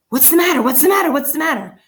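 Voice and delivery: high, loud alarmed cries, easing on the last; startled cry. A narrator's reading. Woken mother shouts in alarm at her son. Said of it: "His mother jumped out of bed and said".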